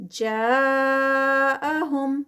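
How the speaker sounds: جاءہم very slow, جا held four counts; four-count madd. Shown as a demonstration. Teacher showing madd muttasil. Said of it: "مد متصل four counts والی".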